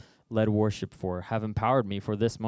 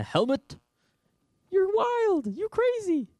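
high, loud mocking incredulity, squeaking at the end; exasperated king; 9 semitones higher, 3 dB louder than usual. King Saul telling David he's crazy, high mocking exasperation. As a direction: build to a shrill "you're crazy".

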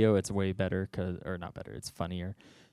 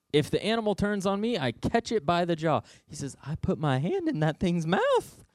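high, quick eager boast; boastful boy; 8 semitones higher than usual. Acted. Young David bragging to Saul about the lion, high and eager. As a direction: brag like an excited teenager.

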